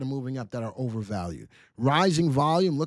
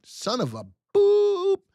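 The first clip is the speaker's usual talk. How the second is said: high, loud minced curse; censored outburst; 6 dB louder, slower than usual. Real, not acted. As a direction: blurt a cut-off curse in frustration. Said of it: "I can't say that".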